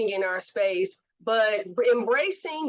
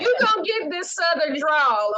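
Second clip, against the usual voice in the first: hype; 6 semitones higher, 5 dB louder than usual. Real, not acted. Hyping up her co-host.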